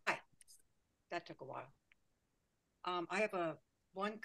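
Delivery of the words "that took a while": faint, far-off voice on a weak microphone; speaking too softly to be heard; barely audible. Real, not acted. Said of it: "your voice is very faint".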